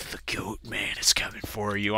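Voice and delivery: creepy, soft whisper; evil whisper. Retold or imitated. Dad scaring his kid through the door, evil whisper. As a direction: whisper it as a menacing tease. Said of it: "just being all evil".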